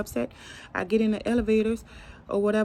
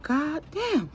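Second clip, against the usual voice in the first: a high, stunned cry of dismay; dismayed outburst; 10 semitones higher than usual. Retold or imitated. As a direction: let it burst out high in disbelief. Third patient died, despairing.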